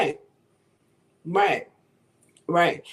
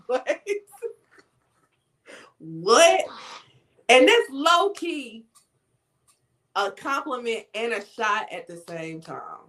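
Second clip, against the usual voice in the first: ordinary talk, nothing marked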